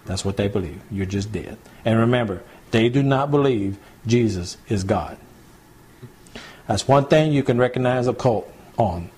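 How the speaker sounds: ordinary delivery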